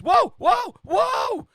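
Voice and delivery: high, loud yelps of alarm; startled, high-pitched shouting. Acted. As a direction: yell like someone hurled through the air. Voices man being thrown through air.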